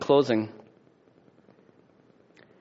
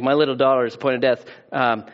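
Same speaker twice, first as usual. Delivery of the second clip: ordinary talk, nothing marked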